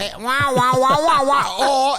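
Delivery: loud, high trumpet wah wah. Retold or imitated. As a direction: blare the Peanuts wah wah. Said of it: "like Snoopy".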